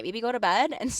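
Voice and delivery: ordinary delivery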